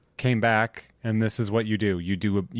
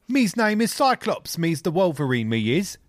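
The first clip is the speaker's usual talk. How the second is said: mock cockney; 5 semitones higher than usual. British bit continues.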